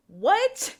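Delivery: high, loud rising disbelief; incredulous. Acted. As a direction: blurt it as a baffled question. Stunned reaction to non-apology.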